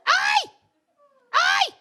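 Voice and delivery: hammed-up shrieking aye; angry woman yelling. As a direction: mimic an irate driver banging at the window. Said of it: "She goes".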